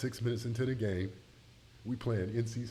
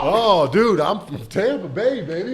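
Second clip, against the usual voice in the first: much louder and higher, laughing between shouts; hyped retelling; 11 semitones higher, 12 dB louder than usual. Real, not acted. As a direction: relive the tantrum with wild glee. Reliving the outburst, hyped.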